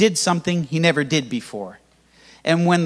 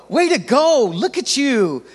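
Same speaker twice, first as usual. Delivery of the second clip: put-on higher cheering voice like praising a toddler; encouraging; 8 semitones higher than usual. Pastor imitating himself cheering the healed man, encouraging. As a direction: cheer him on, delighted. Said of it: "Like, I'd be encouraging".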